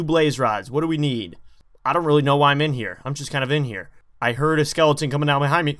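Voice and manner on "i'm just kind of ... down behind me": ordinary talk, nothing marked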